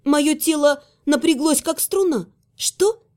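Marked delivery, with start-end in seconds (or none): 2.6-3.0 high, startled question; shocked. Acted. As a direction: jolt at the threat. Shocked by threat.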